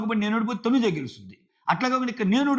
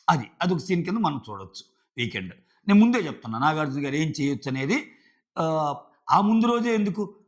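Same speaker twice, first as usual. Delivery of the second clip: ordinary delivery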